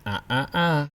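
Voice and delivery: "Ah, ah, ah" cut by the throat, last one rising; "Ah, ah, ah" with throat closing between each; finger-wag uh-uh sound. Shown as a demonstration. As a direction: say "Ah, ah, ah" like a scolding no-no. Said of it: "sound you make as you wag your finger".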